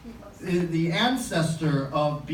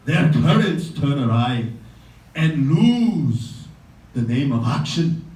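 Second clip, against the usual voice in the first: Hamlet soliloquy recital. Acted. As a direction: declaim it like a stage Hamlet, brooding then raging. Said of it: "so here he goes".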